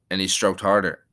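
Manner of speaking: ordinary delivery, nothing marked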